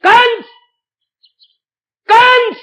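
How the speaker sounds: thundering, high loud call; Yogmaya. Acted. Goddess calling out to Kans from the sky, thundering. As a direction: cry the name out like a stern goddess. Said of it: "कैसे वो कड़क के बोली थी".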